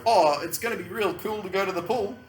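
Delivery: imitating an Adelaide accent on cool and pool; southern Australian accent. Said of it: "that's how they talk".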